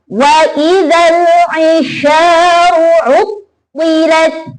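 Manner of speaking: slow chant, pressed shadda in "utwilat"; careful teaching reading of "utwilat"; stressed recitation. Shown as a demonstration. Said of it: "Perhatikan tekanannya ya".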